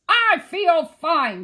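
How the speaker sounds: very loud, slow, high protest; shout. A narrator's reading. Museum man insisting to police, loud drawn-out shout. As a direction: stretch each word in a shout. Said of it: "shouted Frizzle".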